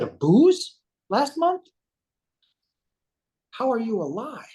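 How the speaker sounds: high, stretched-out retold disbelief; incredulous. Man reacting to an alcoholic's spending, incredulous. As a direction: question it in a high, amazed voice. Reacting to huge drinking bill.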